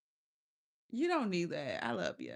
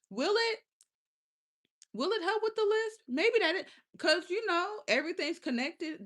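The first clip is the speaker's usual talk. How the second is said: hopeful question; 9 semitones higher, 4 dB louder than usual. Real, not acted. Hopeful question about minerals.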